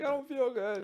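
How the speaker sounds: high, weak moaning complaint; sick whiny voice. Retold or imitated. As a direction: whimper it like you feel ill. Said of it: "You just be like".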